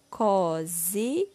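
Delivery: COZIDO drawn out slowly as co, zi, do; syllable by syllable. Shown as a demonstration. Teacher completing the word with syllable có, slowly syllable by syllable. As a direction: sound out COZIDO one syllable at a time. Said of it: "Iremos utilizar a sílaba có".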